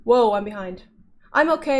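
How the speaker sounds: normal talking voice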